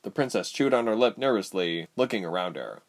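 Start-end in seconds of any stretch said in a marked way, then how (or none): none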